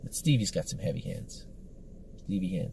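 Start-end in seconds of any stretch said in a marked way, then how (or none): 2.2-2.7 put-on half-hearted French accent, said softly. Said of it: "That was my best French accent".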